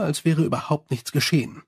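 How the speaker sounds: plain reading voice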